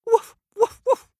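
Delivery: high, loud yapping woofs; yappy barks. Acted. The kitten barking at the farm animals, high and loud. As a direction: bark like a small dog. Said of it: "said the kitten".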